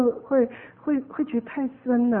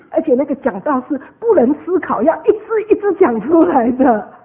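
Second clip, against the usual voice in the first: higher, much louder stress; emphatic insistence; 4 semitones higher, 9 dB louder than usual. Real, not acted. Preacher explaining preaching in Taiwanese, emphatic insistence. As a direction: drive the point home at raised volume.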